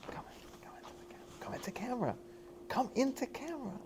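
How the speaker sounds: hushed urgent call into frame; whispered come into camera; whispered beckon. Real, not acted.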